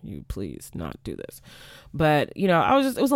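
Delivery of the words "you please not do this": quietly pleading imitation; pleading request. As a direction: beg them not to cut the pay. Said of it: "go back and tell them, like".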